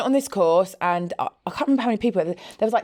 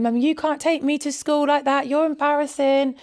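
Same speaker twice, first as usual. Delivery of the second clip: put-on high, whiny child's plea; mild mock of a tearful daughter; imitating daughter; 7 semitones higher than usual. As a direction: whine like an embarrassed kid. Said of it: "Isla was crying, going".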